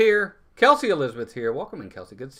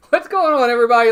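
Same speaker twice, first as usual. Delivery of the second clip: excited greeting; 6 semitones higher, 9 dB louder, faster than usual. Real, not acted. Second stream greeting.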